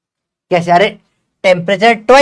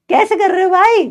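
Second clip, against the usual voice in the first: exasperated; 12 semitones higher than usual. Real, not acted. Teacher exasperated at students' answers.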